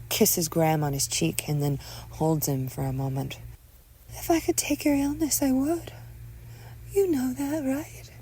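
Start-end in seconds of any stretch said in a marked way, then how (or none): 4.1-8.1 high, gentle motherly voice; tender mother. Acted. Mother holds sick son, high tender voice. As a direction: soften to almost a whisper by the end.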